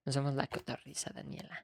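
hushed teasing whisper. Real, not acted. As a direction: whisper it like a secret. Whispered scolding aside.

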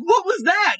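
high, choked question; castmates' high, teary what was that; teary castmates. Retold or imitated. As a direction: squeak it out through tears. Crying cast asks him.

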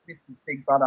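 quoting the house voice, a low, soft imitation; Big Brother voice. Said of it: "when he says".

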